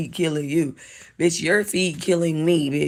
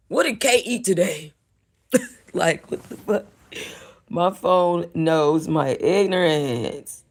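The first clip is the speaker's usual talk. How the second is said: ordinary delivery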